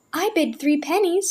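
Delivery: high, whispered panicky bid; nervous little girl's bid; child bidding in a panic. A narrator's reading. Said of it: "in a panicky voice".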